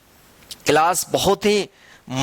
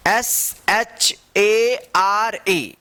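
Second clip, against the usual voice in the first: each letter drawn out slowly; slow spelling out; much slower than usual. Shown as a demonstration. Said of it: "इसको क्या पढ़ेंगे?". (अक्षर-अक्षर धीमे से बोलना)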